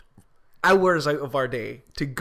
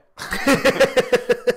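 higher laugh bursting out; loud laugh; 8 semitones higher, 7 dB louder than usual. Real, not acted. Co-host laughing at her own punchline, loud high laugh. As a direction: laugh hard at the joke.

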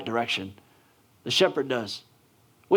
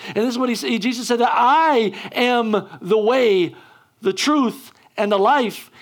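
high, loud, driving sermon voice; preaching at full force; 10 semitones higher, 4 dB louder than usual. Acted. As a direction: hammer every refrain home to the congregation. Preaches at full force, repeated refrains.

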